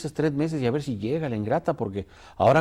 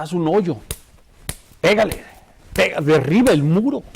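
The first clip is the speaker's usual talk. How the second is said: God's loud command; 3 semitones higher, 7 dB louder than usual. Retold or imitated. God commands the prophet.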